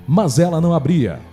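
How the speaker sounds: normal talking voice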